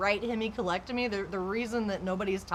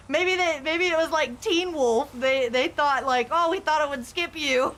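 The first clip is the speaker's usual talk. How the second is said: mocking friend; 6 semitones higher, 4 dB louder than usual. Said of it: "and he goes".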